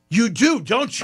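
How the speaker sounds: a touch of mock insistence; insistent. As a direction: press her knowingly. Friend presses the woman.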